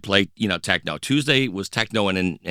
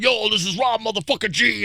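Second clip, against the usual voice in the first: high hyped-up club MC intro; MC shout-out; 11 semitones higher than usual. Retold or imitated. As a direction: announce yourself on the mic like a hype man. MC shout-out on the microphone.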